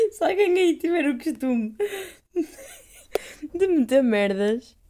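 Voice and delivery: laughing through words. Real, not acted. Laughing at own stumble.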